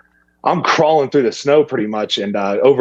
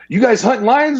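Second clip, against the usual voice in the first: imitated eager question, much higher; eager rancher; 12 semitones higher than usual. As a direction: ham up the rancher's hope. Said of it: "he was like".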